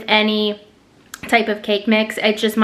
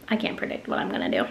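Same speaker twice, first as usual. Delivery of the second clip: whispered aside; 9 dB quieter, faster than usual. Real, not acted. Muttered self-aside.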